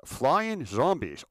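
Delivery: slow, stretched-out big idea; imitated producer savoring his own idea; grand pitch. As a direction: unveil it slowly and grandly.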